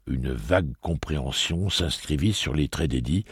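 normal talking voice

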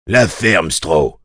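mildly barked order; a touch of a bark to silence an underling; aboiement sec. A narrator's reading. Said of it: "aboya Habert". (ordre légèrement aboyé)

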